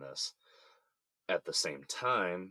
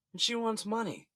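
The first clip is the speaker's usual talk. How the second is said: indignant outburst; 4 dB louder than usual. Acted. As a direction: pitch jumps way up in disbelief. Exasperated complaint about ex.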